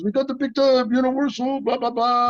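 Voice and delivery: high, excited French impression; excited mimicry trailing into blah-blah; Jean-Claude. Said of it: "he was all excited".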